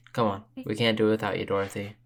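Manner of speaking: ordinary talk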